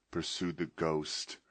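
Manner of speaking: plain reading voice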